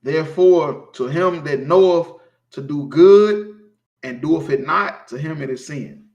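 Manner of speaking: loud slow verse, dropping at the end; solemn verse fading to a hush; scripture reading. Acted. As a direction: read the verse with weight. Reads scripture aloud.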